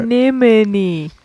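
"Anemone" drawn out syllable by syllable; slow demonstration. Shown as a demonstration. Co-host teaching the disputed word, "Anemone" said slowly. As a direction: say "Anemone" slowly and deliberately, stretched out.

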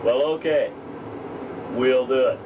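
lightly voiced cheerful partner; cheerful pretend chat. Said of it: "start a tape something like this".

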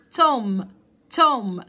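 توم without the final b; silent b. Shown as a demonstration. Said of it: "البي سايلنت ما تنطقهاش".

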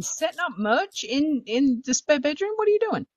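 bewildered, voice jumping up high; incredulous. Real, not acted. Wife to husband setting up merch in the bedroom, incredulous.

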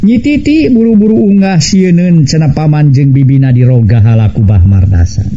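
usual speaking voice

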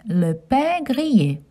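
le pain grillé with nasal pain, gree-yay; French pronunciation. Shown as a demonstration. Teaching children le pain grillé. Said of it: "Toast in French".

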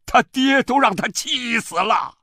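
very high, loud accusation; angry shout. A narrator's reading. Father-in-law throws blame at the mother, shouting angrily. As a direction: yell the blame straight at them. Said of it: "冲着我娘喊".

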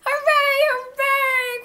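high, loud whooping; drawn-out, exultant hooray; jubilant cheering. A narrator's reading. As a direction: cheer at the top of the voice. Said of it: "cried the funny little clown".

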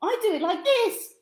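high, loud, bleating goat; high and loud, playful goat denial. Acted. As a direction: bleat the answer out. Goat answers.

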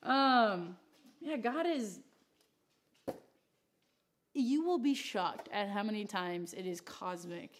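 normal talking voice

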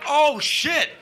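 mock alarmed cry; shocked. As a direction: react to the crash. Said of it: "I'm like".

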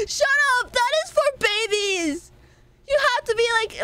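high indignant shut up; high playful yell. Real, not acted. Streamer squealing at chat who mocked her height, indignant.

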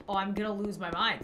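usual speaking voice

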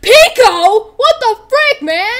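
high, loud cry of disbelief; play-shout in shocked outrage; outraged yelling. Pretend. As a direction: yell it high at a friend. Boyfriend confronts Pico in improvised story.

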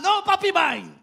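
put-on high, loud toddler protest; upset little boy. Pastor voices his son pulling his hand, high toddler whine. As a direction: whine it like a child tugging dad's hand.

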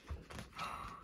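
quiet shocked intake of breath; soft gasp. A narrator's reading. Narrator reacts to Julie's answer, soft gasp. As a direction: gasp softly at the news.

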